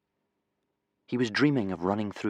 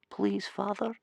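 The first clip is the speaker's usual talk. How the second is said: small, high-pitched plea, kept soft; hushed, high child's voice in the dark; whispered plea; 12 semitones higher, 7 dB quieter, slower than usual. A narrator's reading. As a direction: plead quietly in a high little boy's voice. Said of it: "Bear Cub whispered into the blackness".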